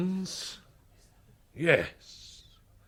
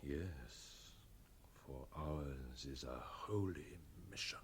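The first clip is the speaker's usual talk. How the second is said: a much softer, hushed vow; reverent whisper; 12 semitones lower, 21 dB quieter than usual. Acted. Captain confiding the holy mission, whispered. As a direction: whisper it like a sacred secret.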